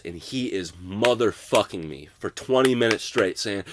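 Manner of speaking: ordinary talk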